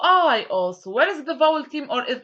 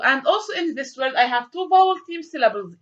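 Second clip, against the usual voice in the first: ordinary talk, nothing marked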